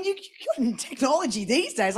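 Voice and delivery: normal talking voice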